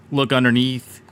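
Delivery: usual speaking voice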